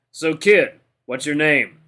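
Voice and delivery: put-on loud, cocky address to a kid; big brash fighter voice; condescending veteran fighter. A narrator's reading. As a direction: size up the rookie out loud. Big fighter sizes up rookie.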